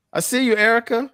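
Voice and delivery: high, loud greeting; excited shout-out. Real, not acted. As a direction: call out to her brightly. Calling out to sister.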